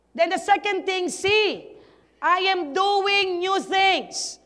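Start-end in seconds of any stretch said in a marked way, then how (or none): 1.1-4.4 each word drawn out slowly and weighed; slow emphatic verse. Shown as a demonstration. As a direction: read the promise slowly, letting it land. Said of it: "This praise contains two loaded truths".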